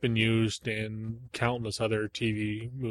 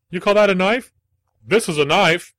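loud, much higher hammed-up Australian accent; horrible Australian accent; 7 semitones higher, 10 dB louder than usual. Quoting Crocodile Dundee to his co-host in a loud, high fake Australian accent. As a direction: boom out a bad Australian accent, pitched way up. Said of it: "speaking in a horrible Australian accent".

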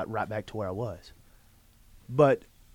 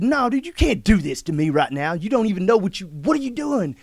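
loud, higher, flustered protest; overwhelmed protest; 7 semitones higher, 9 dB louder than usual. Retold or imitated. A man overwhelmed by a stranger's kindness, loud and flustered. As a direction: push back, bewildered. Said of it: "I said".

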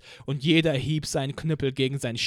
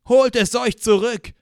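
loud, higher answering shout; crowd roaring back; 7 semitones higher, 8 dB louder than usual. Acted. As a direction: roar the slogan back as the crowd. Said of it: "antwortete die Menge".

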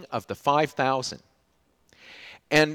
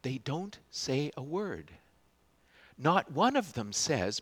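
ordinary talk, nothing marked

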